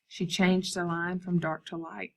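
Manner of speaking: ordinary delivery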